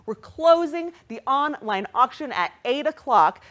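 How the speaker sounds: ordinary delivery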